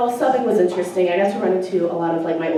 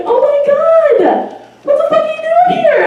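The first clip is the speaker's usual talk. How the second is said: shocked squeal; 10 dB louder than usual. Retold or imitated. Math teacher recognizing her old babysitter, squealing in shock. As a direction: stretch out the shock with a laugh.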